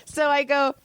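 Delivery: usual speaking voice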